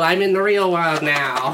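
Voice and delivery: ordinary talk, nothing marked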